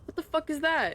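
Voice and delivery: shocked and loud; startled. Real, not acted. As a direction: blurt it out in alarm. Sudden creature sighting.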